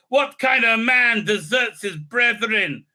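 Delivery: hammed-up indignant challenge; pissed off. Elf rebukes the bloke she is with, pissed off. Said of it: "she's all pissed off with him".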